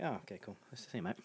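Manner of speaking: casual, unbothered. Acted. Greeting a spider that's on him, softly nonchalant. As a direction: play it totally unfazed, voice dropped.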